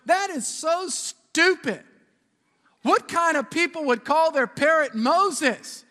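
loud, high, drawn-out disbelief; incredulous scorn. Acted. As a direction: scoff like an exasperated burglar. Burglar scoffs at parrot's name.

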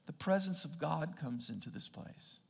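ordinary talk, nothing marked

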